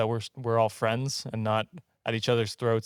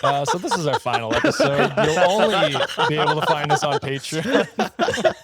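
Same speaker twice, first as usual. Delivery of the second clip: louder, laughing through the words; laughing joke; 3 semitones higher, 7 dB louder than usual. Real, not acted. Host jokes the show is ending, laughing louder. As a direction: fake announcement cracking up. Joking about final episode.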